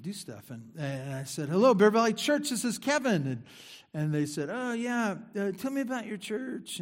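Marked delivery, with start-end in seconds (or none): 1.5-3.3 high, loud receptionist hello answering the phone; bright phone greeting. Retold or imitated. Answering the church phone as receptionist, high cheery hello. 4.5-6.8 caller voice. Retold or imitated.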